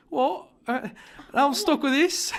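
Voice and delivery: high, loud mock English accent; high-pitched cartoonish English accent; put-on English accent. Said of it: "so you speak in an accent".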